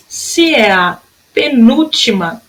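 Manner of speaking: normal talking voice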